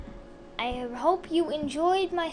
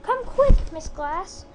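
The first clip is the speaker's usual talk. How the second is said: calling out; 3 semitones higher, 8 dB louder, slower than usual. Acted. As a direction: call the teacher over urgently. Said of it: "I shout".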